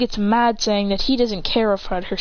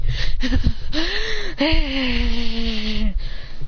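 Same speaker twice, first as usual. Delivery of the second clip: villainous laugh; 3 semitones higher than usual. Acted.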